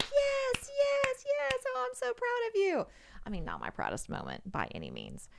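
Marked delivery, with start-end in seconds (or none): 0.0-2.9 very high, loud squeals of approval; shrieky acted pride; imitated cheering. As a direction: voice sorority sisters cheering on their friend. Sorority sisters cheering her on, retold.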